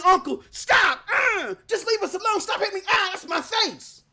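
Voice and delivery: high-pitched mock pleading; mock screaming. Imitating Xbox fans crying uncle, mock screaming. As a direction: imitate someone yelping under a beating. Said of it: "then they're screaming".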